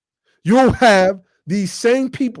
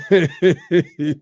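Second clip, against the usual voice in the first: genuine chuckle; amused laugh. Real, not acted. Host laughing at beating his opponent, amused chuckle. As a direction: laugh because it's really funny. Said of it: "I'm laughing 'cause it's funny".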